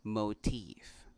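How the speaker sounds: "motif" as moh-TEEF; correct pronunciation. Shown as a demonstration. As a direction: round o diphthong, then stressed TEEF in "motif". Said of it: "this word is pronounced".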